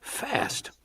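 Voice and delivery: breathy whisper of awe; whispered thought. Acted. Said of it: "Thorne thought".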